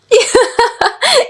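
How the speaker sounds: high-pitched teasing laugh; giggling at grandad; high giggle. Acted.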